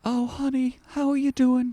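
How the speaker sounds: imitating the wife sweetly asking; husband mimics his wife checking on him; mock wife voice. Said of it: "the wife was like".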